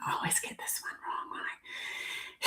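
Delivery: a soft whispered aside to herself. Real, not acted. Reader whispering to herself while unsure of the card name, hushed whisper. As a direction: whisper it to yourself, half forgetting the mic.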